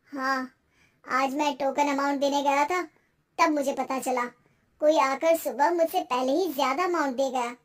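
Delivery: low, downcast man's voice; =sad low male character; dejected husband. Acted. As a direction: explain the lost deal, disappointed.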